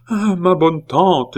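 high, slow, loud wail; alarmed pleading. A narrator's reading. Frightened nephew begging his aunt, pleading. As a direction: beseech with a drawn-out cry. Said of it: "firent frémir Pitou".

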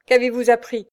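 angry outcry. A narrator's reading. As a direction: snap the question at him. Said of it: "s'écria monsieur de Virelais". (s'écrier avec colère, plus fort)

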